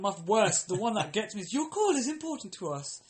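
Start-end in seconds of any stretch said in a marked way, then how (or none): 1.5-3.0 high, sing-song recorded voice; mock hold message.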